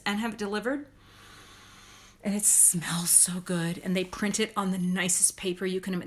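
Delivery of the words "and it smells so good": whisper. Real, not acted. Praising the magazine's paper.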